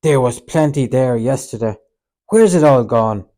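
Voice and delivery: loud, sharp accusing questions; angry demand. A narrator's reading. Peter confronts Judas over missing money, angrily. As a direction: demand it, furious about the money. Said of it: "Peter stood up angrily".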